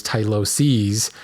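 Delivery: "tyloses" in three syllables, ending "seez"; corrected pronunciation. Shown as a demonstration. Host corrects his own habit, carefully pronounced. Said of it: "I should be referring to it as".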